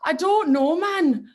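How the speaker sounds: loud, high, drawn-out Geordie; Geordie accent. Shown as a demonstration. Singer showing off a Newcastle accent on request, hammed-up Geordie. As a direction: put on a strong Newcastle accent, stretched out. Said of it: "really good rendition of a Geordie accent".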